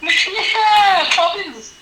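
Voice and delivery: laughing through a high mock reply; laughing mock Brit. Joking about British talk, laughing high. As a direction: giggle the name out high.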